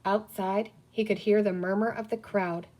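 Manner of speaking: usual speaking voice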